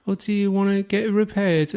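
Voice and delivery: high, hammed-up condescending sales voice; mock high-pitched insurance seller; English saleswoman imitation. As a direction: play a patronising English phone saleswoman. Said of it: "she said".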